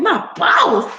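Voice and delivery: retold sassy shout quitting on the boss; indignant comeback. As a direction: scoff at the idea she's your boss. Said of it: "I said".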